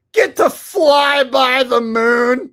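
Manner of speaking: high, loud, drawn-out wail; sarcastic triumphant yell; mock-emotional shout. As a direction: holler it slowly with fake awe.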